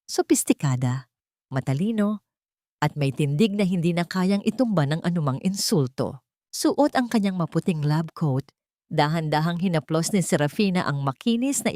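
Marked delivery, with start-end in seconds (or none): none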